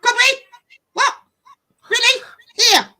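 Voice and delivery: loud, high, aggressive Glaswegian barks; imitating a loud Glasgow accent. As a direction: fire each word like a challenge.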